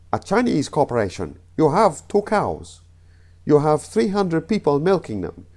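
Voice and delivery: put-on Chinese accent. Comedian telling the Chinese corporation joke, Chinese accent. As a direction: do a rough comic Chinese accent. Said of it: "I know the accents were awful".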